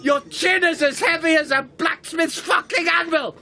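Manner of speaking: hammed-up yells of agony, crying out; pained shouting. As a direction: scream at him to let go. Still crushed, in pain.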